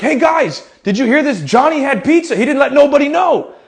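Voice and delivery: high, hammed-up calling out; playful, high-pitched scandalized announcement; mock public announcement. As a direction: call the whole team over in pretend outrage. Playfully announcing child's lunch to team.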